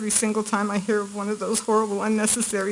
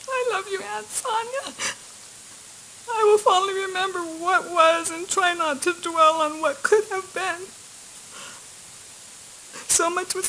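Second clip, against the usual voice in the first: crying; 9 semitones higher than usual. Real, not acted. Aunt's court statement for niece.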